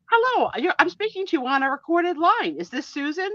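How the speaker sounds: high-pitched imitation of a telemarketer; spam caller. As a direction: open a sales call in a put-on high voice. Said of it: "a lot of spam calls".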